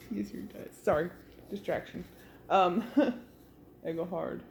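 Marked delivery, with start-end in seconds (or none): none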